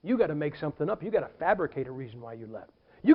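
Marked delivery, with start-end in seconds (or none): none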